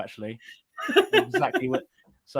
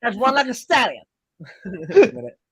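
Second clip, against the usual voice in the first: higher, louder mock-posh gent with a laugh; 5 semitones higher, 4 dB louder than usual. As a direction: put on a South Park-style posh voice. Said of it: "my posh voice sounds like it's fucking South Park".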